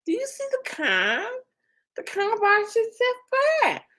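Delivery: high-pitched mock Boston accent. Streamer quoting the self-parking car commercial, hammed-up Boston accent. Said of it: "I kept talking like that the whole summer".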